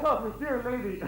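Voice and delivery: chuckling through the words; laughing king. Acted. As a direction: laugh it off heartily.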